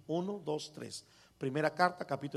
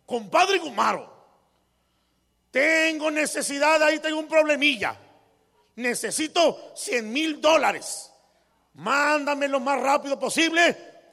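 loud, high and pushy, slowing at the end; rude demanding letter; 7 semitones higher, 6 dB louder than usual. Retold or imitated. Rich man reading compadre Chon's letter as an insult, loud and pushy. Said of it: "así hablaba ya el señor".

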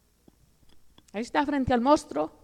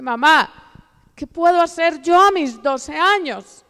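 raised, slow plea of a retold child; heartbroken plea; 4 semitones higher, 5 dB louder than usual. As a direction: plead loudly and higher, voicing a hurt girl. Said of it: "con su corazón roto, con una tristeza horrible". (súplica dolida de niña, imitada)

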